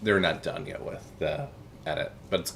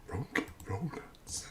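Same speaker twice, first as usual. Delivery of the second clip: whispering; 3 semitones higher, 10 dB quieter, slower than usual. Real, not acted. Whispered font name.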